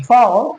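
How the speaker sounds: फाओ with L turned into an "o" glide; drawn-out फाओ, tongue never landing on L; dark L demo. Shown as a demonstration. Teacher models the correct word, dark L demo. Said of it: "इस तरीके से".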